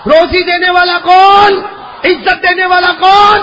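slow, high, very loud questions; rousing shout. Real, not acted. Preacher demanding the congregation's answer, rousing shout. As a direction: belt it out, stretching for a response.

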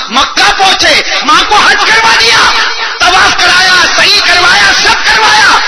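high, shouted triumphant list; full-force preaching. Real, not acted. Preacher telling how a son completed mother's Hajj, shouted preaching. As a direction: ring out each completed rite.

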